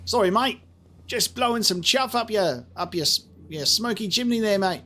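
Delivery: high, hammed-up Australian accent; cheeky over-the-top Australian accent mocking the NPC. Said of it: "another terrible Australian accent".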